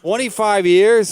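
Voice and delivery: loud, high, drawn-out disbelief; astonished. Real, not acted. Co-host hearing how long they've known Stacy, astonished. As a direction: stretch it out in loud amazement. Astonished echo of the figure just given.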